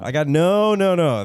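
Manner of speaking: loud, high repeated denial; emphatic no. Real, not acted. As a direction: cut them off firmly. Insisting he has no doorknob.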